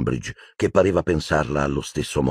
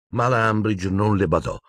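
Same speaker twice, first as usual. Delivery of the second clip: ordinary delivery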